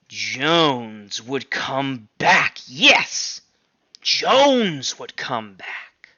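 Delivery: slow, drawn-out threat; ominous warning. Acted. Squealer scaring the animals about Jones, slow ominous. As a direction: let the threat hang, very slow.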